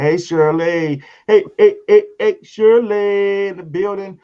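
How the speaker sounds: loud, high, drawn-out shout-out; calling out greeting. Real, not acted. Host welcomes Shirley to the show, hollering. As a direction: holler a big welcome, stretching the name. Calls out to arriving viewer.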